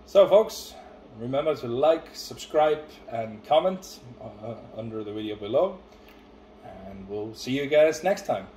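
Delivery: loud, upbeat YouTube sign-off; outro announcer. Acted. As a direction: sell the outro to viewers. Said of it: "it's your turn this video".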